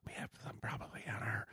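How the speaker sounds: hushed conspiratorial whisper. Real, not acted. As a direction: whisper it like a secret. Joking secret about beer strength.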